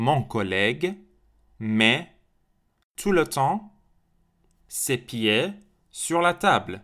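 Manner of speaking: slow, chunked delivery; slow chunked example; model sentence. Shown as a demonstration. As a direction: drill the sentence slowly. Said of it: "teacher models example sentence".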